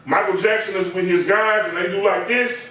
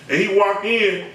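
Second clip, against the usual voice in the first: usual speaking voice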